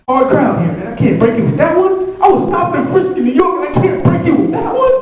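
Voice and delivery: high, pleading gripe at the crowd; mock-hurt protest to the audience; exasperated complaint. Real, not acted. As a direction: whine that the room won't laugh. Complains joke gets no laughs.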